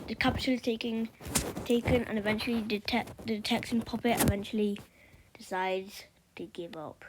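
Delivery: ordinary talk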